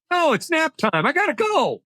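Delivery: high-pitched joking getaway line; comic quick exit. Acted. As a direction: toss it off high like an escape excuse. Joking calm is really fatigue.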